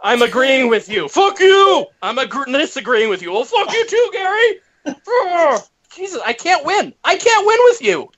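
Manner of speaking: high-pitched, exasperated yelling. Real, not acted. Co-host who can't win against Gary, yelling. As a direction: blow up in exasperation, pitch soaring. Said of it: "why you're yelling at me".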